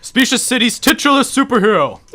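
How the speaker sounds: loud, slow heroic boom; grand superhero. Acted. Superhero to city folk, booming slow arrival. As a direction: announce yourself like a pompous hero.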